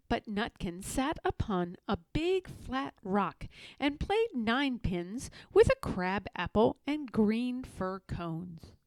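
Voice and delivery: ordinary delivery, nothing marked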